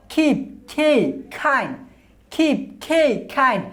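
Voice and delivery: খিপ, খেই, খাইন slowly, each খ with a puff of air; slow aspirated K words. Shown as a demonstration. Teacher drills learners on aspirated খ words. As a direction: stretch each word, breathe out on the খ. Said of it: "কে রে আমরা খ উচ্চারণ করব".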